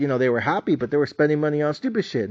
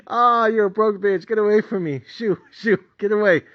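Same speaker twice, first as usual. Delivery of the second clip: dismissive mockery; 8 semitones higher, 3 dB louder than usual. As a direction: brush him away like a pest.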